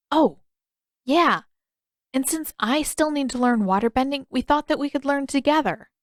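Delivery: put-on eager young boy. A narrator's reading. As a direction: catch the hint and jump in.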